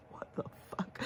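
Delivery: stunned whisper as the dog; whispered dog thought. Pretend. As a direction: whisper the dog's shock. Dog's shocked thought voiced.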